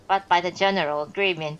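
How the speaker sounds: usual speaking voice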